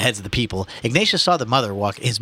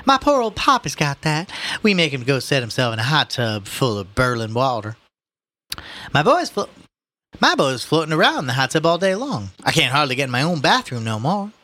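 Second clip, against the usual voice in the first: higher-pitched New Orleans shop gossip; chatty saleslady, commiserating; 5 semitones higher than usual. Acted. Saleslady telling a customer about her poor old father, commiserating. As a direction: swap family complaints in a folksy, raised voice.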